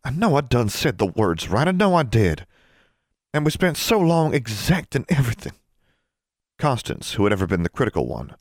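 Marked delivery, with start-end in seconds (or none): 0.0-5.5 high-pitched, upset protest; distraught. A narrator's reading. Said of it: "was quite distraught".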